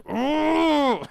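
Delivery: high, loud mock "ooh" of frustration; drawn-out, high-pitched exasperated ooh; imitated frustrated squeal. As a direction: squeal it out like someone driven mad. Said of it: "get her to go like".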